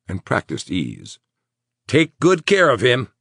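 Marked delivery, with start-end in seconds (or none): 1.9-3.1 officious, loud mayor; mayor's voice. A narrator's reading. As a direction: speak like a pompous official. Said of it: "in his mayor's voice".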